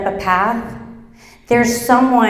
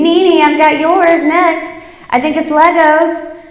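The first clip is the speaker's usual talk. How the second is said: put-on bright, higher kid voice calling louder; young girl guessing her gift; eager little girl; 7 semitones higher, 5 dB louder than usual. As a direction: play the excited daughter. Child voiced eagerly in story.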